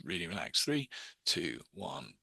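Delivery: hushed, low, sinking with each number; hypnotic countdown. Shown as a demonstration. Hypnotist counting a subject down into relaxation, soft hypnotic countdown. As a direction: count them gently down, dropping to almost nothing.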